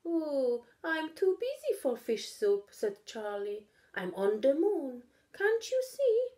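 a hint of an impatient kid; busy little cat. Charlie's voice again.

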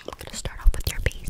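normal talking voice

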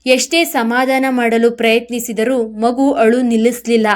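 usual speaking voice

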